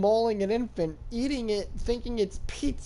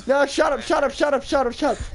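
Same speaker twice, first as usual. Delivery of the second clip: higher, insistent rapid-fire repeats; real shushing interruption; 4 semitones higher, faster than usual. Real, not acted. Storyteller silencing friends who interrupt, insistent and rapid. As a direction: cut them off with a quick volley.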